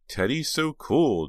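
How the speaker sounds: slow, high, gushing praise. Acted. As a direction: gush over him. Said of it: "Jade gushed".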